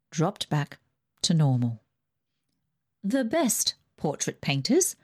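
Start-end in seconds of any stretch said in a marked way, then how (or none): 3.0-4.9 put-on higher, imperious old aunt; Aunt Ida's voice. Rich aunt dismissing the family, higher and bossy. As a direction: lift the pitch and command like a rich aunt. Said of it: "continued Aunt Ida".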